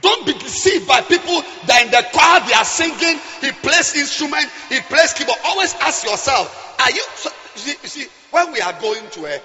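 ordinary delivery

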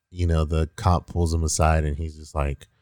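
normal talking voice

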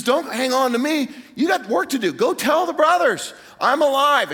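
high, energetic instructions; rousing acted send-off; excited urging. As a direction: send her off with urgency, voice lifted. Acting risen Jesus sending Mary off.

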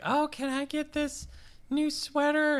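a put-on girly plea for the sweater; mock sweet ask. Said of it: "so she's like".

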